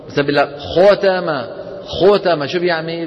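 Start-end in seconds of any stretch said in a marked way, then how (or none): 0.6-2.5 ختم with lips pursed, "khotama"; rounded fatha. Retold or imitated. Teacher mimics false heaviness, fatha rounded to o. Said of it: "بيضم شفايفه".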